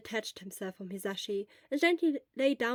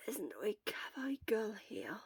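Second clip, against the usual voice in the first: faint whispered question; weak whisper; 7 dB quieter than usual. Acted. Sick Shura asking for the nurse, whispered. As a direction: barely voice it, drained. Dizzy patient asks weakly.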